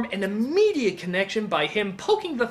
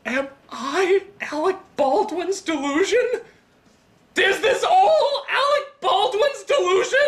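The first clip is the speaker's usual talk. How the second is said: high, slow questioning, building to a wail; mock hysterical breakdown; 10 semitones higher than usual. Critic doubting his own existence, mock hysterical breakdown.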